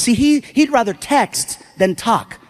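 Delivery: ordinary delivery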